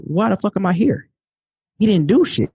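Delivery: fuming in disbelief at the school; incredulous outrage. Retold or imitated. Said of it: "I'm sitting there thinking".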